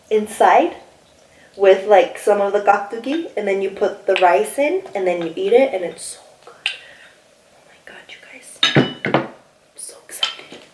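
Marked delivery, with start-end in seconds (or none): 5.7-10.6 hushed, breathless whisper. Real, not acted. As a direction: whisper it like a secret treat. Whispers about how good it is.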